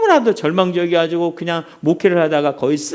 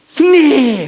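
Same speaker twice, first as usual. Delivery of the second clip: loud, high victory call; referee's shout; 5 semitones higher, 5 dB louder, much slower than usual. Retold or imitated. Referee raises Rocky's arm and shouts victory, loud call. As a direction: proclaim the winner. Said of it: "외친 거예요".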